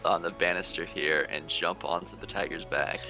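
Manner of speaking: ordinary delivery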